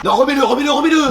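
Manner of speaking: high, urgent repeated plea; comic horrified insistence; panicked plea. Acted. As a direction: beg in rising alarm. Alarmed at removed tape.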